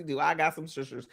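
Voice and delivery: ordinary talk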